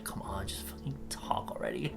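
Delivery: whispered, exasperated aside; muttered impatience. Acted. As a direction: whisper it as if urging a screen. Acted impatience at unedited video.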